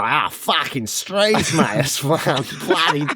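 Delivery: broad mock bogan Aussie; bogan Australian accent. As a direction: ham up a rough working-class Australian accent. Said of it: "I like my Australian accents quite bogan".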